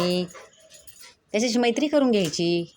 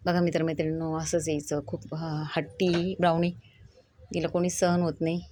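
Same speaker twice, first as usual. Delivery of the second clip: normal talking voice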